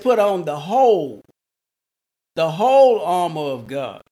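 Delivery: "whole" hit loudly both times it comes; stress on whole. Shown as a demonstration. Preacher repeating the verse to the congregation, emphatic "whole". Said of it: "I want to emphasize that word whole".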